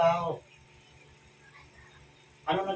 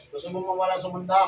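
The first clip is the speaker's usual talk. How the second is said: ordinary talk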